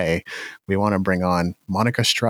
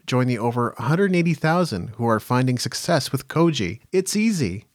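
scripted podcast ad with swinging, raised pitch on each item; sponsor ad read; 4 semitones higher than usual. Acted. Host pitching Koji to musicians, upbeat scripted ad read. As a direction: punch through the list like an ad voiceover.

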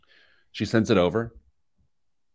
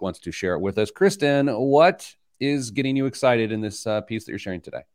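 bright, louder announcer read; radio host intro; 3 semitones higher, 4 dB louder than usual. Acted. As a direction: open the segment like going live on air. Host's on-air segment intro.

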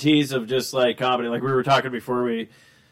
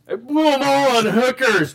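high, slow goofy voice; imitating a dim character, higher and slow; mock dopey confession; 9 semitones higher, 5 dB louder, slower than usual. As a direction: drag it out like a clueless guy owning up. Acting out movie dialogue.

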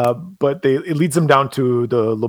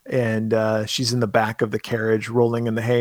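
ordinary delivery

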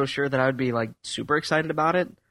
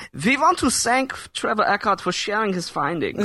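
German supervillain voice; 9 semitones higher than usual. Acted. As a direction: read it like a menacing German supervillain. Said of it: "that German supervillain voice".

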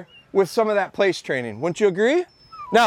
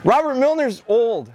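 usual speaking voice

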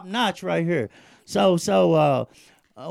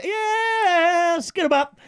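high, excited catchphrase shout; high hype call; 10 semitones higher, 3 dB louder, slower than usual. Real, not acted. Host greeting a viewer with the catchphrase, high and excited.

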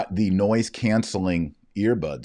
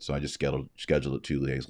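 numb mouth; 4 semitones lower, 6 dB quieter, faster than usual. Real, not acted. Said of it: "slurring there? I can feel it. I'm still numb".

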